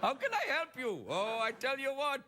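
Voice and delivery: high, comic fake-American call-centre voice; badly faked American accent from an Indian operator; high-pitched mock Indian-American cheer; Indian pretending American accent. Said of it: "guy in India pretends he's not in India".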